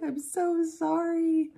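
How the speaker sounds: high, slow, teary "I'm so sorry"; crying apology. Retold or imitated. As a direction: plead through a mild retold sob. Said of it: "I just started crying".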